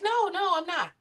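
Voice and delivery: high-pitched imitation of a defensive daughter; mimicked teen denial. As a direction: play a teen brushing off the question, pitched high. Daughter denying being annoyed.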